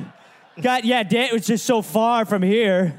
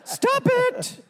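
shrill fake lady scream. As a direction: scream like a damsel in distress. Voicing Charles's fake lady cry.

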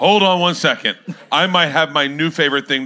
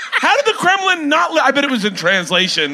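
ordinary talk, nothing marked

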